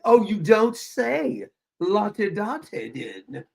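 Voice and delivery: slow, exaggerated la-di-da reply; mock posh. Mocking Clever's British airs, la-di-da. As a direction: tease with drawn-out put-on refinement. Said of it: "She sounded so British".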